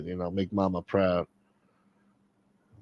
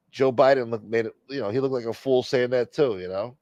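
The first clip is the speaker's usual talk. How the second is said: ordinary talk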